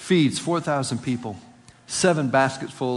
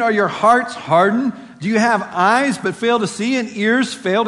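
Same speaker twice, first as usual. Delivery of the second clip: loud, higher-pitched reproachful questioning; exasperated rebuke; 7 semitones higher, 7 dB louder than usual. Acted. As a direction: scold them in disbelief, voice raised and pressing. Jesus rebukes disciples in scripture reading.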